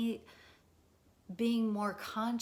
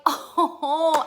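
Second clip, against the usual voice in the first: delighted oh; 9 semitones higher, 12 dB louder than usual. Retold or imitated. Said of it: "We're like".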